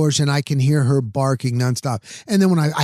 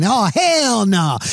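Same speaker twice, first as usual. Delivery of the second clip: drawn out, slow and higher; imitating Fournette's yell; 7 semitones higher, much slower than usual. Retelling Fournette's yell from the podcast, slow mock outrage. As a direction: stretch it like a player calling it off. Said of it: "Leonard Fournette was yelling".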